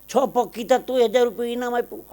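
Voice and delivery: high-pitched disbelieving question; incredulous mother. Acted. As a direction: question the child in rising disbelief. Mother incredulous at son's prize.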